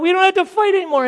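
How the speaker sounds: very high, loud, fast mock joy; hammed-up euphoric celebration; ecstatic shout. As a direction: whoop it out, overjoyed. Couple celebrating, acted.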